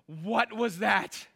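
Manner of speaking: very slow, stretched-out disbelief; mock bewilderment. Preacher voicing onlookers left in the mess, mock bewilderment. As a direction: drag each word out, stunned.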